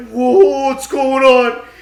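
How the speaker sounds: loud, slowed alarmed cry; mock panicked yell. Jordan running in panic, loud alarmed cry. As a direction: yell out in confusion.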